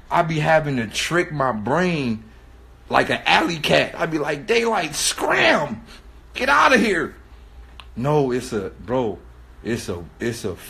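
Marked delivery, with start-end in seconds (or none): 4.5-7.1 high, stretched mock yell; drawn-out comic order to leave; shooing away. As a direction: chase off a stray cat. Shooing himself like alley cat.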